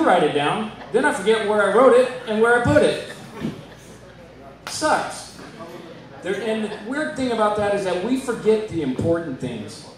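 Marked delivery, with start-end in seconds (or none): 4.7-5.4 a high, breathy whisper; muttered; whispered aside. Acted. Bitter one-word punchline.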